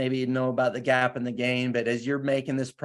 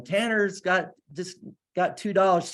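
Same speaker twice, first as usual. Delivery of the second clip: put-on higher-pitched retelling of a happy kid's announcement; imitated excited child; 6 semitones higher than usual. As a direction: lightly act out a little girl's excited news. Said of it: "running around the house screaming".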